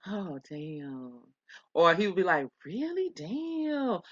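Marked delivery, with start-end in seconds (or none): 0.1-1.2 "Oh, damn." said much softer, almost under the breath; a toned-down, muted "Oh, damn."; changed tone. Retold or imitated. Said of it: "he would change his tone". 2.6-4.0 "Really? Damn." pitched much higher and softer; a mock-innocent, high "Really? Damn."; changed tone. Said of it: "he would change his tone".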